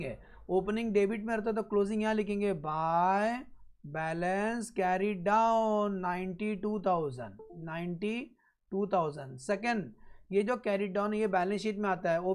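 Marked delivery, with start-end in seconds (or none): 2.5-9.4 very slow, drawn-out words; slow dictation. Real, not acted. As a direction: dictate it slowly for note-takers. Teacher dictates the entry while writing. (धीरे-धीरे लिखवाते हुए)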